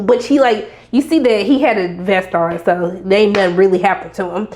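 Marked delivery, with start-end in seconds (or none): none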